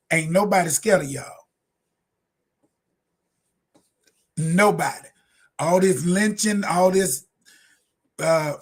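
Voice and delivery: heated, defiant preaching rant; getting mad. Real, not acted. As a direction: push back hard and fired up. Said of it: "Can y'all tell I got mad?".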